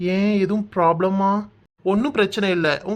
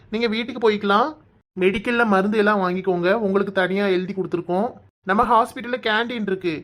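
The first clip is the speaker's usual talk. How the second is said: ordinary talk, nothing marked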